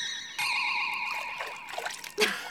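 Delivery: soft breathy giggle of triumph; delighted laugh. Real, not acted. Girl laughs softly as the water appears. Laugh after the spell works.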